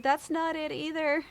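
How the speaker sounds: high, loud, upset insistence; mildly acted distressed correction; retold tearful protest. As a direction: protest through imitated tears. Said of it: "they saw that I was crying".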